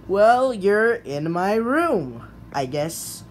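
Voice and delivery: ordinary delivery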